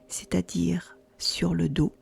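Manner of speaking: calm yoga nidra guidance, long pauses between phrases; unhurried, soothing relaxation-guide delivery; guided meditation. Acted. Guiding a listener into yoga nidra, calm meditation voice.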